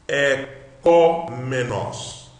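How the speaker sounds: loud, drawn-out syllables. Shown as a demonstration. Teacher shows students a Greek word, syllable by syllable. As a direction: stretch "có" long and loud. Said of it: "Vamos, então, ver isto".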